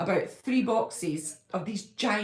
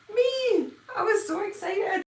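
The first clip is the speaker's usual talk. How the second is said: much higher, squealing with joy; thrilled; 12 semitones higher, 3 dB louder than usual. Real, not acted. Vlogger revealing a YouTuber featured her channel, high thrilled squeal. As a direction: burst out with delight at the reveal.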